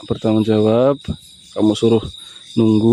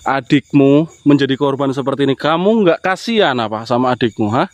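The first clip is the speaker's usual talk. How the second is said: raised, accusing voice, rising higher at the end; reproachful scolding; 4 semitones higher than usual. Real, not acted. Vlogger confronts Mas Yanto over his little brother, reproachful. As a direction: scold him for abandoning his sibling.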